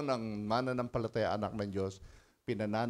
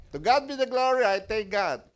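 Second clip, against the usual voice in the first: loud, high praise; fervent Tagalog praise to God; praise shout; 10 semitones higher, 9 dB louder than usual. Real, not acted. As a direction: proclaim with force. Preaching praise at full force.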